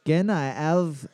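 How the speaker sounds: put-on slow, low French accent; mock French accent, drawn out. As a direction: ask for a conure like a Frenchman ordering a canoe. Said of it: "Like a very Frenchman".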